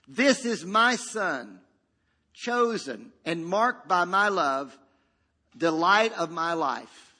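slow, weighty declaration; measured, emphatic fatherly pronouncement. Acted. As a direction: proclaim it in spaced-out phrases. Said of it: "the Father is, about his son".

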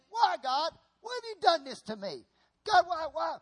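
high, loud wail at God; aggrieved, loud "why me" complaint; complaining. Acted. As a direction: plead and protest, pitch pushed up. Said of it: "all he's doing is complaining".

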